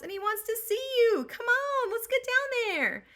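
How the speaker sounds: the nanny drags her along, high and thrilled; excited nanny. Retold or imitated. Nanny urges Penelope downstairs.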